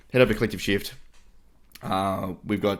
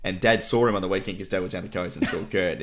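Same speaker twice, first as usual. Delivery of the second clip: ordinary delivery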